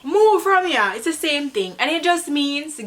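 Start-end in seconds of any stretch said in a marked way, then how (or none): 0.0-0.9 high, dismissive patois; mild shoo-away. Shown as a demonstration. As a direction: wave someone off, up high.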